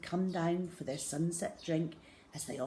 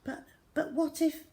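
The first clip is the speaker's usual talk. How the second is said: worried mother; 10 semitones higher, 3 dB louder than usual. A narrator's reading. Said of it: "mother was saying".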